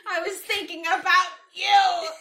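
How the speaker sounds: high, loud line through laughter; cracking up. A narrator's reading. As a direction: try reading the romantic line while laughing. Reader cracks up mid-quote.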